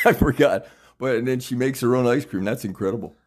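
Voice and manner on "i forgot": laughing. Real, not acted. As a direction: repeat I forgot with a laugh, higher and louder. Repeats it laughing at himself.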